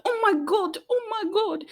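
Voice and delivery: high, frantic oh my gods; freaking out. Retold or imitated. Amber freaking out down the hallway, high panicked squeal. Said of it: "freaking out on the hallway".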